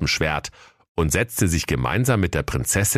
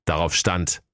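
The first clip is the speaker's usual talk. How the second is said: ordinary delivery, nothing marked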